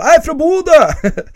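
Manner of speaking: high, loud, drawn-out yell; hammed-up hometown war cry; mock battle shout. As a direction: shout your hometown like a challenge. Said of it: "er det nå jeg skal rope".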